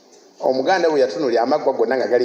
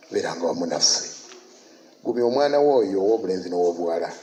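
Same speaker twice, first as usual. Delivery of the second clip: prayer; 3 semitones lower than usual. Real, not acted. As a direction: lead the church in earnest prayer. Leads closing prayer.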